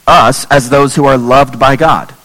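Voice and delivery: usual speaking voice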